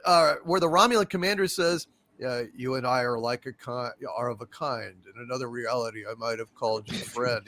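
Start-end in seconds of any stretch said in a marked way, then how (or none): 2.2-7.4 low, soft mock-bored line reading; yawning delivery. Host imitating the Romulan commander's farewell, bored yawning delivery. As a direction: mimic an actor too bored to finish. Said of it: "I think he yawns in the middle of it".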